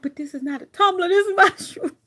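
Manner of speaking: climbing high and loud on "my shoe"; retold protest to the group, ending high and loud with a laugh; exasperated protest. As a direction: insist it's a shoe, not a tumbler, half laughing. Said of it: "I'm like".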